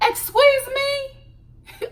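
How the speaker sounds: high, very slow, drawn-out offended excuse me. Real, not acted. Reacting to Paul's DNA test demand, scandalized and drawn out. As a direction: stretch it out, scandalized.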